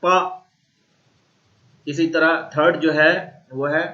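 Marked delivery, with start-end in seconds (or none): 0.0-0.2 पा dropping sharply downward; falling tone pa. Shown as a demonstration. Teacher demonstrating fourth-tone पा. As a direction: snap पा down. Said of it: "last tone".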